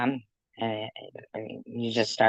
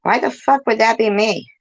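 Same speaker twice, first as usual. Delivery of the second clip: high, loud indignant protest; indignant denial; 9 semitones higher, 5 dB louder than usual. Real, not acted. Caller denying the account is theirs, indignant. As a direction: protest hotly, voice pitched right up. Denies being the accused account.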